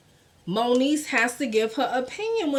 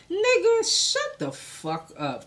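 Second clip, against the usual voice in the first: ordinary delivery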